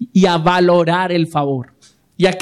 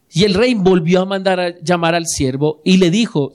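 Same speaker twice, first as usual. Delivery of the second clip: usual speaking voice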